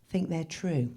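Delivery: ordinary talk, nothing marked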